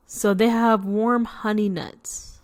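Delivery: plain reading voice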